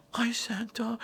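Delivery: high, soft whisper; awed child. Retold or imitated. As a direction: whisper like a child greeting Santa. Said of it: "And then Ellie".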